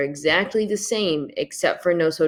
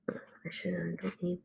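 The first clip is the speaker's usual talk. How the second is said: whispered aside; 18 dB quieter than usual. Real, not acted. Muttering to herself mid-lesson.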